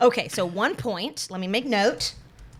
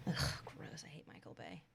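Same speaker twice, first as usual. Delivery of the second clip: 1 whisper; 10 semitones lower, 23 dB quieter than usual. Real, not acted. Disgust aside.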